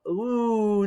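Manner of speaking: ordinary talk, nothing marked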